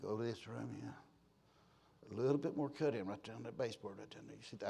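low, very soft boss muttering; inspecting boss. Retold or imitated. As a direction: imitate a boss quietly pointing out flaws. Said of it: "he'd always come in like this".

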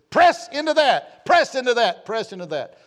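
from a high loud press down to plain; fading preaching cry. Acted. Preacher voicing John the Baptist urging people, fading shout. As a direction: start ringing, then let it settle.

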